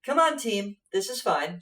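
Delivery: forced cheer. Acted. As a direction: hustle them along with fake brightness.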